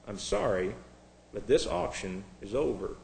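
low, soft and final; hushed, regretful auctioneer; quiet announcement. Acted. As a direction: break the news gently, voice dropped. Auctioneer solemnly ends the auction.

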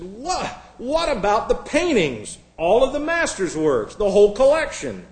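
high-pitched, incredulous protest; stunned. Acted. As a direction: act out a shocked bidder demanding answers.

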